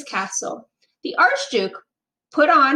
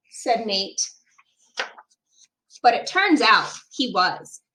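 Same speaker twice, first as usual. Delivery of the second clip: ordinary delivery, nothing marked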